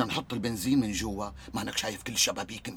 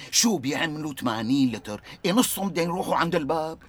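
louder, higher sceptical challenge; incredulous objection; 6 semitones higher, 7 dB louder than usual. Acted. Friend challenging Jamal's fuel plan, loud incredulous. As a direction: question the plan in disbelief.

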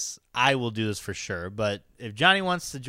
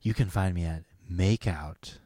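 sexy voice; 7 semitones lower, 6 dB quieter than usual. Pretend. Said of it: "this sexy voice".

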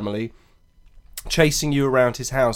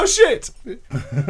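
surprised; 11 semitones higher than usual. Real, not acted. Phone just dropped.

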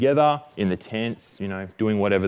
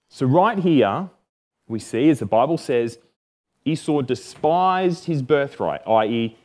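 usual speaking voice

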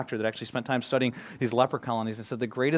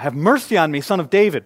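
loud raised plea for mercy; crying out; 4 semitones higher, 12 dB louder than usual. Retold or imitated. Blind man crying out to Jesus, loud plea. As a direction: call out to Jesus passing by. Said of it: "he starts to cry out".

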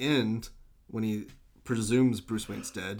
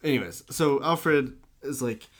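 ordinary delivery

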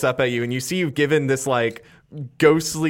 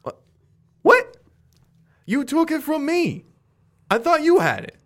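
much higher, loud startled what; shocked disbelief; 4 dB louder than usual. Acted. Skid confronted about the book, startled and high. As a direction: squeak out the question in alarm. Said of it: "Skid shocked about book".

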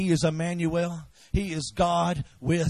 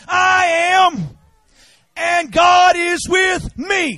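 much higher and louder, slow stretched words; shouted declaration; 9 semitones higher, 9 dB louder, much slower than usual. Shown as a demonstration. Pastor leading the church to declare God is with them, shouting. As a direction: declare it like you mean it. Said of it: "Come on, say it".

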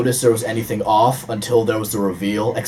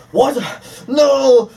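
shocked Kylo; 12 semitones higher, 4 dB louder than usual. Retold or imitated. Acting out Kylo's shock.

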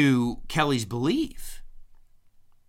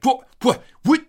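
high, startled stammering what; a mock-flustered brother caught off guard; 12 semitones higher, 7 dB louder than usual. Brother stumped by his wife's questions, high flustered stammer. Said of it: "He's like".

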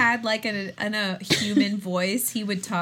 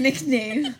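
ordinary talk

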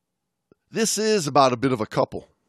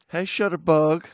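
Southern accent; 3 semitones higher than usual. Real, not acted. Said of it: "You almost had a Southern accent there".

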